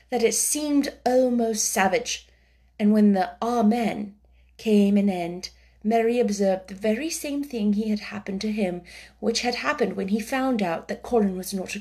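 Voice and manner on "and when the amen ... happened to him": ordinary delivery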